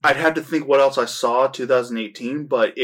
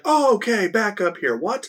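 high-pitched, mock-startled double take; startled reaction; 9 semitones higher than usual. Viewer reacting to a shocking film, startled. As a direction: act out being thrown, voice leaping up.

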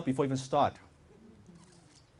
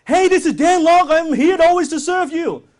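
high, loud, hyped-up greeting; the wrong, salesy way; overeager salesperson; 14 semitones higher, 12 dB louder than usual. Shown as a demonstration. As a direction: sound far too eager to serve. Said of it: "Just listen to my tonality".

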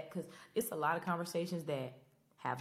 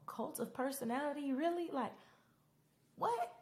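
incredulous disbelief; 12 semitones higher than usual. Real, not acted. Fan baffled by the show's song choice, high incredulous squeak. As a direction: voice leaps much higher in baffled disbelief.

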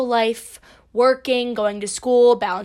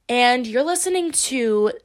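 usual speaking voice

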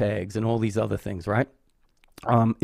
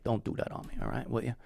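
holding back tears; 10 dB quieter, faster than usual. Real, not acted. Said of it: "I'm not gonna get emotional today".

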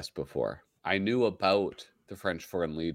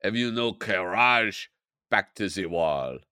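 put-on French accent. Acting out comic sergeant.

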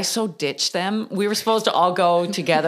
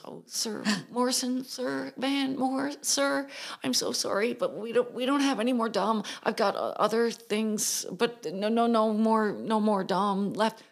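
flustered waitress; 5 semitones higher, 5 dB quieter than usual. Retold or imitated. Flustered waitress apologizing to Van.

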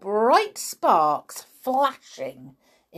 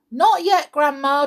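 calling back; 7 semitones higher, 6 dB louder than usual. Acted. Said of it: "George called back".

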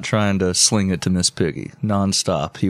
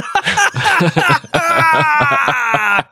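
long, high, shrieking laugh; helpless laughter; 4 dB louder than usual. Real, not acted. Co-host loses it over the dead-dad line, helpless laughter. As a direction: crack up completely and let it peal out high.

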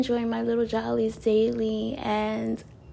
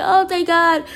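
relieved words spoken through laughter, louder; laughing relief; 6 semitones higher, 8 dB louder than usual. Retold or imitated.

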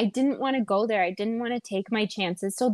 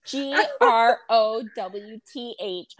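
slow letter by letter spelling; spelling it out slowly; slower than usual. Shown as a demonstration. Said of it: "Fucking growth".

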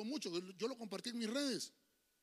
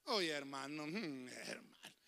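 mock shivering; 6 semitones lower, 7 dB quieter, slower than usual. Shivering in the cold.

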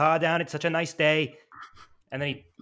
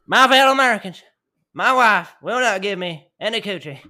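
louder, higher hammed-up president; mock presidential podium speech; 4 semitones higher, 7 dB louder than usual. Friend imitating Bush at a podium, a hammed-up speech. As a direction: boom it out like a podium address. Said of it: "imagine he came out on a fucking podium".

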